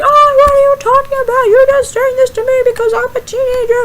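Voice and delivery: high, loud mock teen tantrum; teenager blowing up. Teenager told no, hammed-up high outraged blow-up. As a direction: whine loudly in outrage, dissolving into nonsense ranting. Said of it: "you probably blew up".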